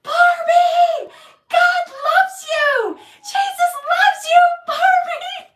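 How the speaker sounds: very loud, high yelling down the hall; shouting. Retold or imitated. Shouting down a crowded hallway to the wheeled-away woman, loud yelling. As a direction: shout after her across the hallway. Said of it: "I start shouting".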